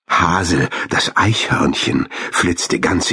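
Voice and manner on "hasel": usual speaking voice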